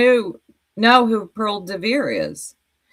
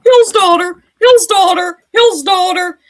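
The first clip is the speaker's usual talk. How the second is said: high, loud mock chant; taunting put-on high voice; mocking imitation; 10 semitones higher, 6 dB louder than usual. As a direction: parrot it in a high, mocking squeal. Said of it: "But now you're all".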